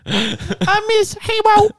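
very high, slow infant whimper; mock baby whine. Mocking the crying son, very high and slow.

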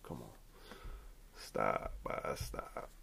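whispered stop. Real, not acted. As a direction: breathe the word softly. Shushing himself, playful.

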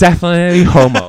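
loud and laughing. Real, not acted. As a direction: blurt it out through laughter. Laughing at a running joke.

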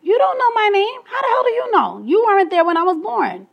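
fed-up protest at people getting her name wrong; indignant outburst. Real, not acted. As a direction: let the outrage fly up high. Indignant rant at people who mispronounce her name.

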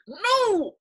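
loud, high outraged no; indignant retold yell; mad outburst. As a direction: burst out in mock fury. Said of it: "I used to get so mad".

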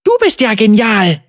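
put-on high, excited boy's burst; blurted admiration. Rescued boy amazed by his rescuer, blurting excitedly. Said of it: "stieß dieser hervor".

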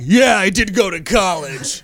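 mock defensive dad voice; a playful jab as the father; imitating dad. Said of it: "My dad's gonna watch this and be like".

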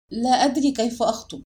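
usual speaking voice

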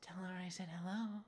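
high singsong teasing; mock teasing Connie. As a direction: pitch it up, playfully suggestive.

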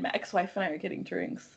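mock-casual, understated mention; casual name-drop. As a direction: toss it off softly. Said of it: "just casually dropping the line".

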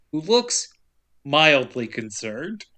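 normal talking voice

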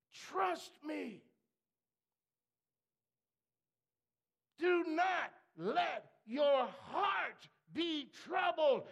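loud, high and slow pulpit shouting; preaching at full force. Acted. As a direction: thunder it out like a revival preacher.

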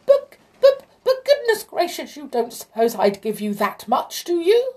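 very high, loud squealing king; angry squeal. A narrator's reading. King outraged at the demand for his gold, squealed in anger. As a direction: splutter and squeal in indignation. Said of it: "squealed the king in anger".

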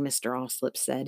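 plain reading voice

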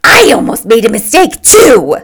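forceful, shrill announcement; vigorous declaration. A narrator's reading. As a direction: declare it with gusto. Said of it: "she declared vigorously".

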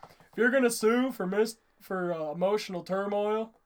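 loud put-on teacher voice, like a cartoon counselor; hammed-up gym teacher impression; Mister Lawrence. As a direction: imitate an exaggerated cartoon school counselor. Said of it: "I love doing the voice".

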